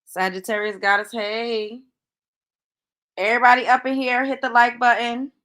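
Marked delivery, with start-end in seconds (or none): 3.2-5.3 quick loud call to viewers. Real, not acted. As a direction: hype up the chat, fast. Host rallying chat again.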